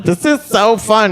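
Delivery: put-on gushing girlfriend.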